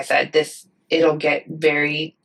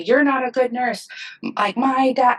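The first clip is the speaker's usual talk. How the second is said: mock yelling; 6 semitones higher than usual. Upset relative berating the nurse, mild mock yell. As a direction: imitate an upset relative lashing out, voice raised in pitch. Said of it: "people yell at me and say".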